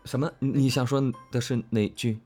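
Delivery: ordinary delivery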